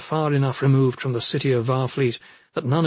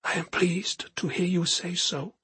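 soft, higher-pitched hushed voice; whispered; 5 semitones higher, 7 dB quieter than usual. A narrator's reading. Woman accepting her companion's vow, in a hushed whisper. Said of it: "she whispered".

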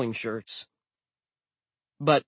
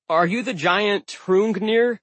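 forced confidence; 8 semitones higher, 6 dB louder, slower than usual. A narrator's reading. Narrator facing giant, trying bold.

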